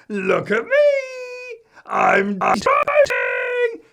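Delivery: put-on very high, loud, slow child's shout. Little Eddie shouting from the driver's seat. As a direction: yell it like a thrilled little boy at the wheel. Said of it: "shouted out at us".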